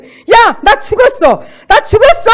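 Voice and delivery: high, full-force repeated yell; shouting back. Retold or imitated. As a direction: scream defiance at the mocking devil. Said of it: "나도 큰 소리 말했어요".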